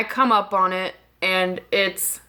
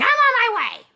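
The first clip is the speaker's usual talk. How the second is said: angry mimicry, pitched way up; super angry. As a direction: imitate a furious friend snapping back. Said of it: "She's super angry at me".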